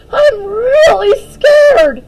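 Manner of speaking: slow, high, loud tearful cry; near tears. Acted. Daphne terrified of the spider, slow tearful cry. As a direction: wail on the edge of crying. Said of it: "on the brink of tears".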